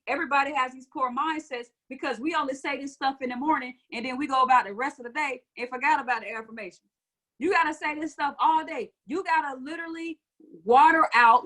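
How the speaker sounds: ordinary talk, nothing marked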